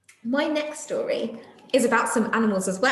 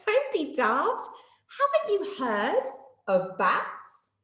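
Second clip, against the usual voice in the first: higher, slow teasing retort; mock laughing; 4 semitones higher, slower than usual. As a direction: tease a friend kindly. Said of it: "Day Monkey laughed and said".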